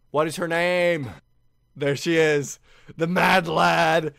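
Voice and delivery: loud, high hype; loud drawn-out Mad Lad; excited recognition. Real, not acted. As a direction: hype her up as she appears.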